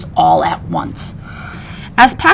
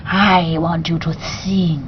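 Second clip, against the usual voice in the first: low, slow, soft slithering voice; soft slithery beast; 4 semitones lower, 5 dB quieter, slower than usual. Acted. Beast commanding the parrot to sing, soft and slithery. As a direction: purr it like a snake made of feathers. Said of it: "voice was soft and slithery".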